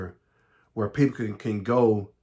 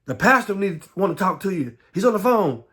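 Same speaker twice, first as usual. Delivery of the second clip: a hint of a mother, higher and urgent; lightly voiced mother calling out; 6 semitones higher than usual. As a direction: hurry him to the phone. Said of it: "my mother saying".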